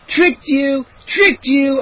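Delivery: high gleeful taunt; cheering. A narrator's reading. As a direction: crow over your successful prank. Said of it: "I cheered".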